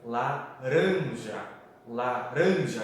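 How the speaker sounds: laranja stretched slowly, RAN swelling loud; stress on RAN. Shown as a demonstration. Teacher shows students the tonic syllable, RAN heavily stressed. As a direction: draw out laranja, punching the middle ran. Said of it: "Aonde tá a sílaba tônica em laranja?".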